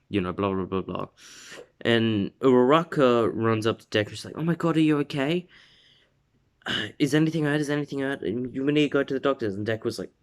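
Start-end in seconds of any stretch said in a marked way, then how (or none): 4.4-9.5 higher-pitched anxious questioning; mock concern, pitched up and urgent; worried fussing. Uraraka checking on hurt Deku, high worried fussing. Retold girl fussing over injured friend.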